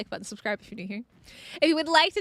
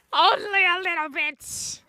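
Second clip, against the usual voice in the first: drawn-out teasing admission; 4 semitones higher, 3 dB louder than usual. Pretend.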